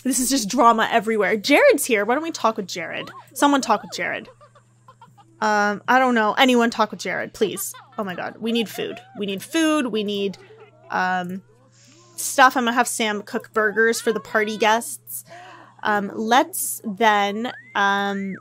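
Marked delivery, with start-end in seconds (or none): none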